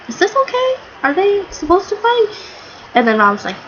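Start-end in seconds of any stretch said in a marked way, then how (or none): 0.1-2.3 very high, worried mock questioning; Jinbei. Jinbei watching his crewmates fight, high puzzled imitation. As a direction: imitate puzzled concern in a high, thin pitch. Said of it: "Jinbei's face when he was like".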